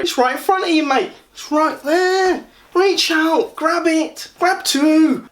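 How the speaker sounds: very high-pitched Essex shop-lad caricature; Gary. Retold or imitated. Shop assistant urging a blind customer to reach out, mock Essex voice. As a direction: squeak out directions in a mock Essex voice. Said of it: "one or two voices of Gary".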